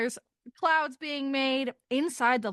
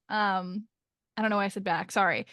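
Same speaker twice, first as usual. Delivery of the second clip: ordinary delivery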